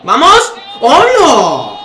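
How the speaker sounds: sudden high yell of joy; excited shout. Real, not acted. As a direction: cheer the goal out loud. Celebrating a goal on stream.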